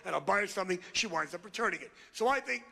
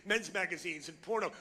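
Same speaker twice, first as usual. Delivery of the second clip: ordinary talk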